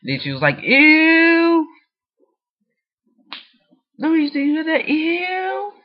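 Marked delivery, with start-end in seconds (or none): 0.6-1.7 very loud, piercingly high imitated squeal; mock disgusted squeal. Friend reacts to having drunk speaker, loud mock-disgusted squeal. 4.0-5.7 very high squeaky imitation; mock squeal. Speaker repeats friend's disgust for viewers, high mock squeal.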